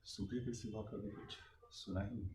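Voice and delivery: very soft, low confession; low, grave murmur; hushed sorrow. Acted. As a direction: let it sink almost to nothing. Sorrowful answer about drought.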